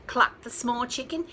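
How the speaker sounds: ordinary delivery, nothing marked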